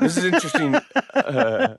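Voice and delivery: high chipmunk voice effect; squeaky cartoon voice. Voice changer still on.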